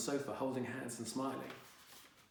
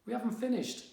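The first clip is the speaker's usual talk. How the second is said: higher, louder, insistent; mum's raised voice as her son storms off; exclaimed; 10 semitones higher, 4 dB louder than usual. Acted. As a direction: stop him leaving the room. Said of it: "exclaimed Mum".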